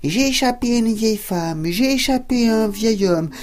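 high, loud sing-song taunt; cheeky cookie shouting back over his shoulder; gingerbread man taunting. Acted. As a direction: tease them from a safe distance, high and bright. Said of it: "répondit".

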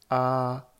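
A held high and level, loud; first tone. Shown as a demonstration. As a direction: sing out a flat, high "A". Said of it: "Avec les quatre tons".

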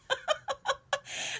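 high, amused laugh; real laugh. Real, not acted. Storyteller amused by the tire shop coincidence, real high laugh. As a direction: laugh at your own bad luck. Laughs at her own bad luck.

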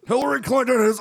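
crying rant, high and loud; hammed-up tearful outburst; Alex Jones impression. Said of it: "I love his Alex Jones".

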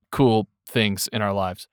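ordinary talk, nothing marked

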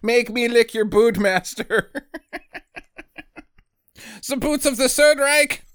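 high pleading voice, laughing; mock groveling plea. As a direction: beg like a bootlicker.

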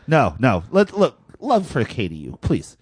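ordinary talk, nothing marked

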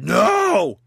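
loud, high ape shout; shouted refusal. Acted. Ape backstage refusing to open the curtain, shouted refusal. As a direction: bellow it out in full force.

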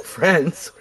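ordinary talk

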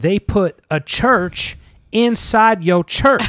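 mock-excited shout, stretched out; hammed-up hype joke. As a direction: crow it slowly like a big punchline. Mock hype meme announcer joke.

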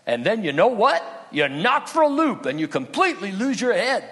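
a worldly owl gushing about spring fever and getting carried away; preacher hamming up Owl's giddy speech on falling in love; Friend Owl, love-struck. Retold or imitated. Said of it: "Owl says".

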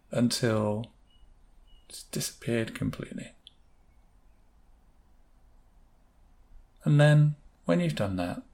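unhurried meditation voice, long pauses between phrases; guided meditation. Acted. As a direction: lull listeners as they picture it drifting away. Leads a guided visualisation.

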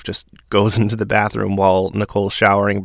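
normal talking voice

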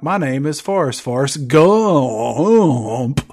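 put-on slow, drawn-out kid's Forrest Gump line; a slow, wavering imitation of the kid. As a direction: stretch "Forrest Gump" out like the child actor. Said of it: "reminded me of that kid".

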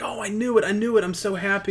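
high-pitched gush of acted happiness; overjoyed. Teacher hearing she's pregnant, overjoyed. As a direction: squeal it like a thrilled mom-to-be. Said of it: "Oh, she's so happy".

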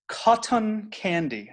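put-on over-pronounce "Cotton candy" slowly in an invented accent; bizarre made-up accent. Father imitating his daughter, bizarre accent on "Cotton candy". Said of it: "where does bizarre accent come from".

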